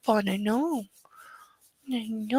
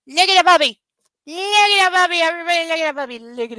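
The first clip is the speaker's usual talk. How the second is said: high, loud delighted call; thrilled to show off the puppy; excited; 7 semitones higher, 8 dB louder, faster than usual. Real, not acted. Excited calling to see the puppy.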